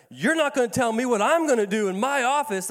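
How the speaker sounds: loud mock fury; mock angry yell. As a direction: snap back at him as the angry lady. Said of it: "that made her mad".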